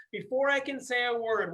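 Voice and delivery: ordinary talk, nothing marked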